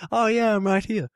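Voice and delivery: high, loud put-on chirpy voice; mock chirpy character voice. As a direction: play her cheerfully oblivious. Mimics the character's return.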